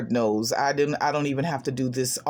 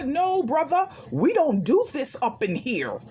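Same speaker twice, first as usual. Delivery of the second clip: snapping back; 11 semitones higher than usual. Retold or imitated. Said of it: "I snapped back".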